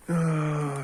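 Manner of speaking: high, loud exasperated ah; frustrated cry. Real, not acted. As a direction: let out a sharp groan. Frustrated outburst.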